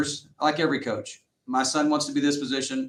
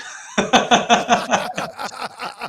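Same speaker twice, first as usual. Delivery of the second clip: a big high burst of laughter; hearty laugh; 10 semitones higher, 4 dB louder than usual. Real, not acted. Coach laughing at his own joke, a big laugh.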